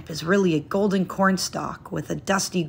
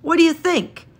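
higher, louder eager calling; excited yell; 11 semitones higher, 4 dB louder than usual. A narrator's reading. Landlady showing the painting to her canary, in an excited yell. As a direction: call out to the bird with delight. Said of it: "Missus Andasola yells".

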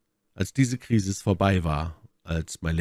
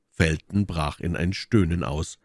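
ordinary delivery

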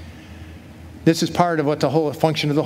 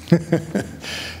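real breathy chuckle; short voiceless laugh. Real, not acted. Preacher amused at his paraphrase to the king, real chuckle.

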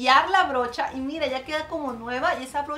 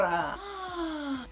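long, high gasp of wonder; delighted gasp; 6 semitones higher, 4 dB quieter than usual. Real, not acted. Reacting to the finished blush, delighted gasp. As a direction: draw in an amazed "ah".

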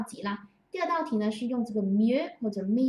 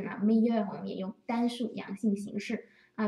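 normal talking voice

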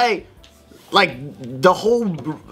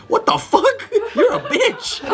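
much higher, laughing through the words; laughing mock retort. Uncle retelling niece's insult, laughing high. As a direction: crack up while quoting the insult.

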